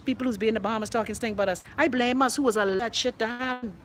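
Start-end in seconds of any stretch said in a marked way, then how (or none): none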